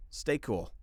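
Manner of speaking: ordinary talk, nothing marked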